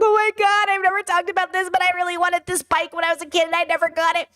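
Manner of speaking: mock tearful wail, pitched high; hammed-up high-pitched distraught confession; distraught crying. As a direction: imitate a man spilling it all, high and upset. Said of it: "he's crying, and he's distraught".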